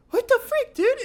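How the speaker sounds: loud falsetto disbelief; mock panicked squeal. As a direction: squeal at your cousin in disbelief. Retold freak-out at cousin.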